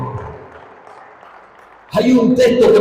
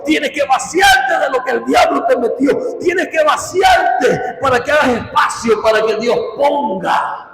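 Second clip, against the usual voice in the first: higher, driving sermon cadence; fervent preaching; 6 semitones higher than usual. Real, not acted. Preacher urging listeners to make space for God, fervent preaching. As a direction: hammer each repeated phrase at the congregation.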